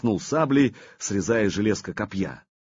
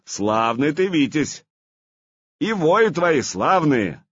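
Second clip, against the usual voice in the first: hearty praise; 6 semitones higher, 4 dB louder, slower than usual. Acted. Vladimir praises, laughing.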